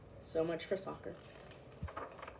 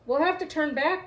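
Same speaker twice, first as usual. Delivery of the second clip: higher, urgent call; 7 semitones higher than usual. A narrator's reading. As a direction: call it over the storm. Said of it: "called another".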